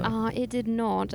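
light put-on British accent, slow; slow playful British accent.

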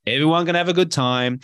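ordinary talk

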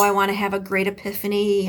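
usual speaking voice

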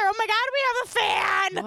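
host relives learning of their first fan, mock-thrilled squeal; thrilled fan news. Gush it high and excited.

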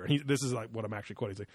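ordinary delivery

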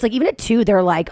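normal talking voice

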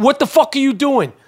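mock-loud demand, volume held back; retold loud challenge. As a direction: re-enact calling someone out, pitch up. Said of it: "It was definitely loud".

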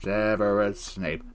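low, slow mock-ominous chant; puppet-show Snape. Cohost chanting a puppet-show bit, low and slow. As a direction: intone it low and slowly as comic Snape.

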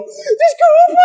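very high wailed apology, played for laughs; imitated frantic crying. Actor apologising to a frightened Zezé, exaggerated mock crying. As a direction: sob out the apology, hammed up. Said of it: "começa a chorar enlouquecidamente".

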